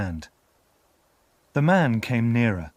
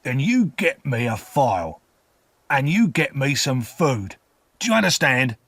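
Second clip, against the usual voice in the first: rough orders building louder; menacing convict; 4 semitones higher, 4 dB louder than usual. A narrator's reading. Convict orders file and food, menacing. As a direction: order the child firmly, building to a demand.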